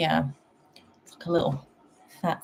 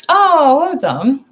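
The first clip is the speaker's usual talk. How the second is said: excited cheer; 10 semitones higher, 9 dB louder, much slower than usual. Real, not acted. Excited at card pull.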